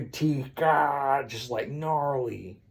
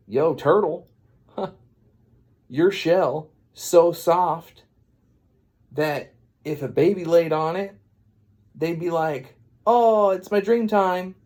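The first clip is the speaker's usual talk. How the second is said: louder, drawn-out rap-battle brag; cocky taunt; 4 semitones higher, 5 dB louder than usual. Retold or imitated. Pinocchio taunting the turtle with a joke, cocky. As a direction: deliver a slow, swaggering put-down.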